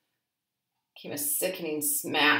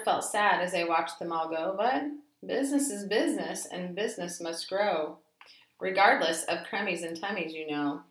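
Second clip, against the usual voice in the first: plain reading voice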